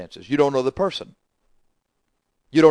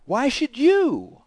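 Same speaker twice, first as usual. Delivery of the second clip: much higher, very slow and drawn out; mocking question; 11 semitones higher, much slower than usual. Preacher turning the question back on the listener, high and slow. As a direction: stretch it out high and teasing.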